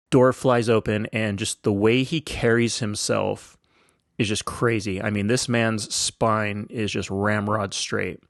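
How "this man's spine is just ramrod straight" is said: ordinary delivery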